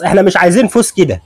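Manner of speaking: normal talking voice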